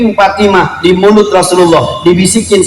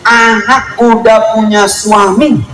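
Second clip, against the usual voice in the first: ordinary talk, nothing marked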